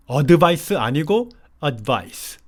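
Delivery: advice with the d swallowed and pressed, gliding straight into vice; 올바른 발음: advice, D를 누르듯; correct pronunciation. Shown as a demonstration. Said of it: "D는 누르는 발음으로 써주세요".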